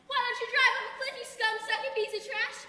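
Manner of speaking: loud, fast, high insult yelled at a catcaller; furious comeback. Retold or imitated. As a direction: hurl it at him, all pretence of manners gone. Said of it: "You know, real ladylike".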